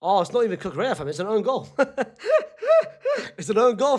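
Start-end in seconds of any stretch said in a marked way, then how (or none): 1.7-3.3 loud high cackle; high burst of laughter. Real, not acted. Own goal reaction. 3.4-4.0 laughing. Real, not acted. Laughing at own goal.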